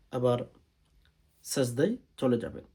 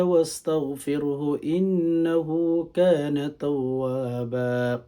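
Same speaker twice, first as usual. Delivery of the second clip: slow, louder, melodic tilawat; Quranic recitation; 3 semitones higher, 5 dB louder, much slower than usual. Shown as a demonstration. Imam teaching the Fajr prayer, chanting Fatiha slowly. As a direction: chant the Fatiha with long held vowels. যেমন আমি ইদা যা পড়তেছি.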